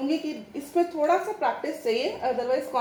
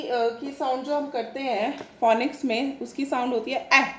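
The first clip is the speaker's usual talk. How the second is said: usual speaking voice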